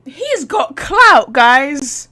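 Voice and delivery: loud, slow, hyped call-out; hyped shout to chat. Real, not acted. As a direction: announce it loudly to the viewers, stretching the words. Hyping to chat.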